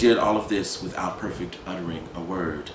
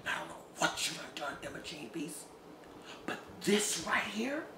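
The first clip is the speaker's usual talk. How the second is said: hushed, breathy threat; Gus going quiet and dangerous; 7 semitones higher, 13 dB quieter than usual. Acted. Gus hushed and menacing toward Emma Jean. Gus speaks low after slapping.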